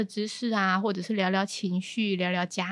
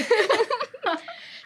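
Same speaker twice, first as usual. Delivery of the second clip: falling laugh; 13 semitones higher than usual. Real, not acted. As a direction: laugh that starts high and falls. Laugh after the host's remark.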